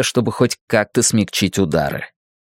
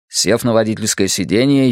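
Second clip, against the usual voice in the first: usual speaking voice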